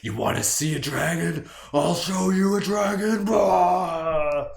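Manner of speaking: high, loud, slow mock roar; hammed-up comeback turning into a roaring dragon; mock dragon rage. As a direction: snap back like a furious dragon. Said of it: "you're just like".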